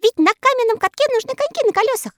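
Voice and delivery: high, fast boastful elf; smug. Acted. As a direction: explain it with pride. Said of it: "сказал самодовольный Скок". (самодовольно)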